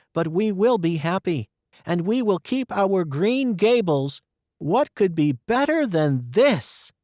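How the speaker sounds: overflowing with hope for the future; girl's joyful speech; Anne joyful. Acted.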